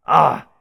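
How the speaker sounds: sudden loud high yell of frustration; frustrated yell. Acted. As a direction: shriek in outrage. Caliborn startled by Nah.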